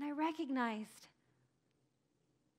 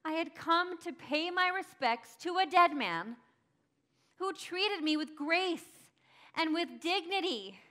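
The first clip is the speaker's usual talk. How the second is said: rising, louder anguished cry; 7 semitones higher, 8 dB louder than usual. Acted. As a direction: let the grief break out. Mary's anguish over Jesus' death.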